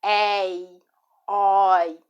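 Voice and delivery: "ei, oi" with open vowels, as éi, ói; open diphthongs. Shown as a demonstration. Teacher showing what an open diphthong is, open-vowel "ei, oi". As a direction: stretch "ei, oi" with a wide-open first vowel. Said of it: "Ditongo aberto é".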